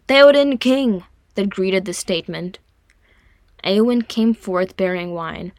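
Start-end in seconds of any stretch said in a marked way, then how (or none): 0.1-1.0 loud, high acclaiming shout; raised rallying cheer. A narrator's reading. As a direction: cry it out as a salute. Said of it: "at the shout of".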